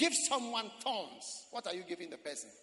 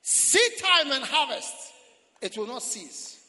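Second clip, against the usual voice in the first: slow and loud, every word weighted; loud slow proclamation; 3 semitones higher, 12 dB louder, slower than usual. Acted. Preacher proclaiming to the church that it will not cease. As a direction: thunder it out like a decree.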